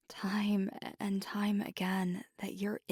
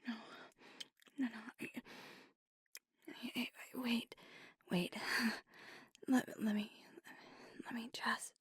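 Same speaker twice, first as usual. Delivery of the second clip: stammering, breaking off between breaths; breathless fumbling plea to wait; flustered halting; 3 semitones higher, 4 dB quieter, much faster than usual. Acted. Lover begs for a moment to collect themselves, flustered.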